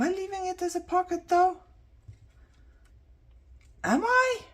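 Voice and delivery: very high, loud mock-puzzled questioning; high squeaky question. Real, not acted. Asking herself in high squeak.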